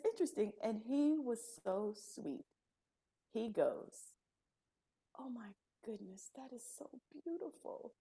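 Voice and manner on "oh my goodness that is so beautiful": softer, hushed, breathy awe; awed whisper. Retold or imitated. Retelling the therapist's awed reply, whispered. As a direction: whisper it as if moved.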